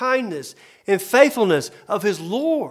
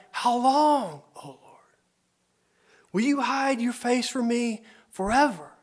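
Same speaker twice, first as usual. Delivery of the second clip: whispered plea; 4 semitones higher than usual. Acted. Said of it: "The psalmist cries out".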